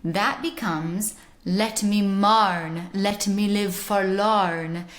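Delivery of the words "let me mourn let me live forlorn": slow original pronunciation, growled hard R in mourn and forlorn; hard rhotic OP accent. Shown as a demonstration. Teacher showing Dowland's rhymes in OP, hard rhotic accent. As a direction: drag out the R's in a heavy rhotic accent. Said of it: "a hard rhotic accent with a hard R".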